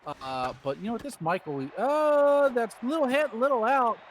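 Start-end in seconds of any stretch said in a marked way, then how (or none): 1.8-4.0 sudden higher, louder burst at the hit; excited call. Real, not acted. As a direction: jump on the call as the ball drops.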